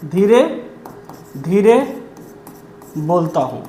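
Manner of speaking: धीरे and धीरे drawn apart, long pauses between them; slow demo of धीरे धीरे बोलता हूँ; said slowly. Shown as a demonstration. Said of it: "और धीरे धीरे बोलना".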